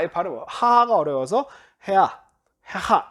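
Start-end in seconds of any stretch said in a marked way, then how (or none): 1.9-3.1 e in the first syllable, a in the second of 헤하; easier altered vowel. Shown as a demonstration. Teacher explaining dissimilation, e-then-a vowels in 헤하. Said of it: "이런 식으로 발음하는 게".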